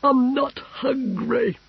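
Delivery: high, slow sulky whine; a spoiled boy whining. A narrator's reading. The Emperor refusing dinner, sulky high whine. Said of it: "with a sulky whine in it".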